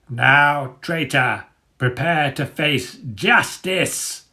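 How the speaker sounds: slow, drawn-out menacing declaration; Dagger's threat. A narrator's reading. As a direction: savour the final threat. Dagger's final attack.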